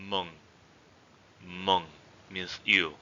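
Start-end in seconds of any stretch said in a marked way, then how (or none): 0.1-0.3 "Meng" dropping slightly in pitch; low falling tone. Shown as a demonstration. Teacher showing the second tone, low falling tone on "Meng". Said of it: "low falling tone". 1.5-1.8 "Meng" with a slight downward glide; low falling tone. Shown as a demonstration. Teacher repeating the word for you, low falling tone on "Meng". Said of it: "low falling tone".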